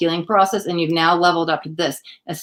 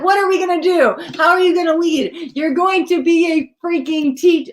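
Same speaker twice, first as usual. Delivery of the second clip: excited outburst; 10 semitones higher than usual. Retold or imitated. Reader retelling her reaction to the teach card, thrilled. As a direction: burst out at the card's message. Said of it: "I said".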